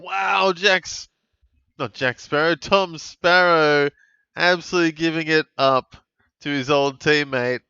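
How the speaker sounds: loud, high, stunned disbelief; excited outburst. Real, not acted. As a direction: practically shout at the screen in shock. Shocked at a teammate's giveaway.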